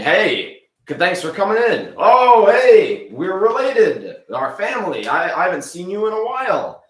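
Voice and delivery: high, loud gushing host greeting; excited welcome. Acted. Ricky welcoming family arriving for his party, gushing. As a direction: gush over the relatives at the door.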